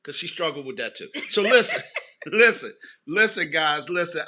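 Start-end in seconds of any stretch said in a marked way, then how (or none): 1.3-4.2 loud laughing listen; laughing, calling for attention. Real, not acted. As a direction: laugh while pleading them to listen. Laughing trying to regain control.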